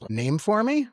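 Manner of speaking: high startled question; surprised. Retold or imitated. Paramedic hearing about his nickname, high surprised echo.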